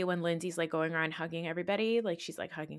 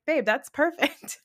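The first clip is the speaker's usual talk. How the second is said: deadpan approval; 7 semitones higher, 5 dB louder than usual. Retold or imitated. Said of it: "And I was like".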